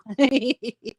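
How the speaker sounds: high-pitched little laugh; brief giggle. Real, not acted. Amused by chat about crock pots.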